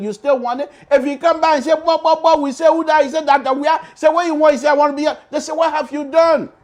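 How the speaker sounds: high and loud throughout, quick back and forth; host imagining the next election's questioning; mock replay. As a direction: rattle off both voices in one shout. Replaying crowd-candidate dialogue.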